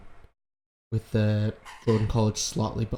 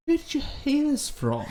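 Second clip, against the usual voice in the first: much higher, lightly voiced questioning woman; prying question. Coulter questioning Lyra about where she heard it, high voice. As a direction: ask sweetly but probing.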